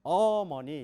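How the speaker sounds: 어머니 with one syllable punched loud; stress on one syllable. Shown as a demonstration. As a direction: hit one beat of 어머니. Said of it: "강세가 있을 경우는". (어머니 강세)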